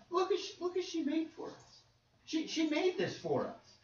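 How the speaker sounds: high, pleading voice of mourning widows; grieving widows. Acted. Widows to Peter, showing garments, in a high pleading voice. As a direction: plead like widows holding up her tunics.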